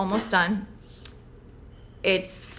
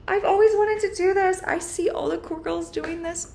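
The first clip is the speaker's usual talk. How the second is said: 1 younger self; 11 semitones higher, 4 dB louder than usual. Retold or imitated. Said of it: "I'm like".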